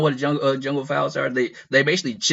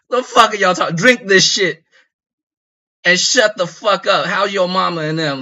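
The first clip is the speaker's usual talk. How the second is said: higher, louder mock bark; dismissive shout; 7 semitones higher, 6 dB louder than usual. The OG tells the crew to shut up, dismissive shout. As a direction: shout them down, then brush it off. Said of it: "in the most dismissive tone".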